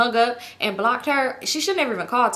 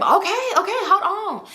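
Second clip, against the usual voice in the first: high, put-upon "okay, okay"; impatient brush-off, pitched up; mock exasperation; 9 semitones higher than usual. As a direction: imitate someone fending off a nagging caller. Imitating her fending off nagging caller.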